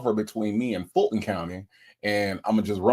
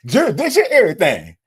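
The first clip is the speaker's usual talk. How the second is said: mock giddy chant, much higher and louder; imitating excited Nesto; 9 semitones higher, 7 dB louder, faster than usual. Host imitating Nesto's excited jurisdiction chant for viewers, giddy mock squeal. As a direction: squeal it like he's sure it frees him. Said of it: "did you hear how happy that Nesto got".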